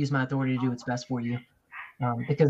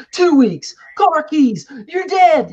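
much higher and louder, hammed-up furious punishments; egotistical dad yelling; 14 semitones higher, 12 dB louder, much slower than usual. Father mocking his own ego-driven overreaction, hammed-up angry yell. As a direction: bark out penalties like a dad losing it. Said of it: "I'm gonna start going".

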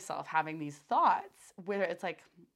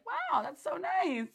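excited gushing; 7 semitones higher, 5 dB louder than usual. Retold or imitated. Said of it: "I'm like".

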